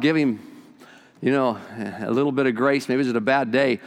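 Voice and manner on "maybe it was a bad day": ordinary talk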